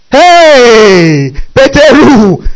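loud, high, drawn-out cry of the name; high-pitched scandalised exclamation; exclaiming in dismay. Acted. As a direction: call out to Peter, appalled. Exclaims at Peter's denial with oath.

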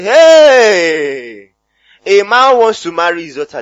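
loud, drawn-out scandalized exclamation; shocked outcry. Acted. Preacher mimicking people who misquote a father, shocked outcry. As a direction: cry it out like sensational gossip.

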